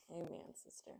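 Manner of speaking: breathy whispered amen; whispered aside. Real, not acted. Reader agrees with the dedication in a whisper. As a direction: murmur it to yourself in agreement.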